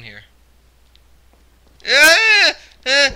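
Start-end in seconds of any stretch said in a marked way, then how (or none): 1.9-3.1 high, loud startled scream; real frightened screams. Real, not acted. Gamer screams as something jumps out. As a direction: scream at the sudden scare. Another jump scare.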